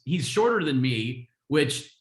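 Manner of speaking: ordinary delivery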